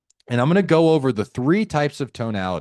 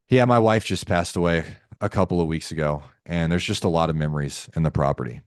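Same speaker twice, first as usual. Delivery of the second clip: low, subdued, halting reply; acted low-pitched sorrow; grieving seller; 8 semitones lower than usual. Acting a grieving widowed seller.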